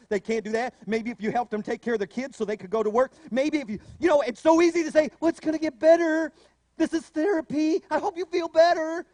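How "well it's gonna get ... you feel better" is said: higher, louder, breezy brush-off; glib mock comfort. Preacher mocking what we tell the suffering, breezy and glib.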